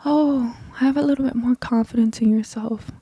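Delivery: lightly mocking the facade she won't put on; mock fake sweet voice. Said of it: "put on a facade here".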